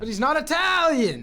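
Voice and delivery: high, loud protest; comic high-pitched outburst; mock outrage. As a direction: yell it in exaggerated objection. Mock outrage over Mario's voice.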